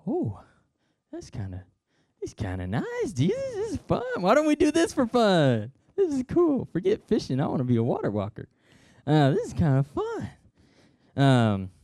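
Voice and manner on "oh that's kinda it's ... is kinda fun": high, giddy excitement; delighted Peter. Acted. Peter stepping onto the waves, giddy and high. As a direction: marvel like a kid on a ride.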